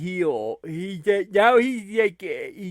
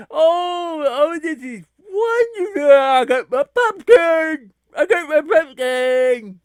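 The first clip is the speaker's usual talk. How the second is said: hammed-up delight; 6 semitones higher, 5 dB louder than usual. Fan settling in for the dream match, overcome with delight. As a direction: overcome with mock joy.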